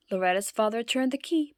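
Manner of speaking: ordinary delivery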